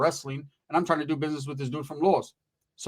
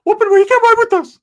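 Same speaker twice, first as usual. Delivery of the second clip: high-pitched screaming character, very loud; Ricky's outraged shriek; 12 dB louder than usual. Acted. Ricky refuses the cousin.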